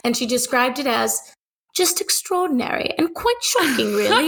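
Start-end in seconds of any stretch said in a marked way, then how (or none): 1.8-4.3 a mock posh English accent; playful fake English accent. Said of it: "How's my English accent?".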